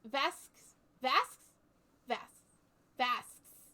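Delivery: "Vasques" as one syllable, "vasks"; tentative French pronunciation. Shown as a demonstration. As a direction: try out "Vasques" with no vowel before the last s. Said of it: "is that how you would say it in French".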